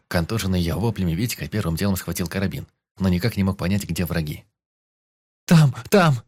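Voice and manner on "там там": high, loud panicked cry; hysterical scream. A narrator's reading. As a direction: scream it in terror. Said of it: "истерично кричала".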